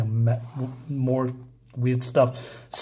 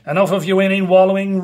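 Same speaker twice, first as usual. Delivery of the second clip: louder commanding rebuke; stern scolding; 4 semitones higher, 6 dB louder, faster than usual. Acted. Spirits rebuke the pilgrim, loud and commanding. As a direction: scold him like a spirit master.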